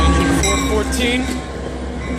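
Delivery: high, slow, drawn-out excited call. Real, not acted. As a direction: call it out with delight. Greeting a passing friend's engine.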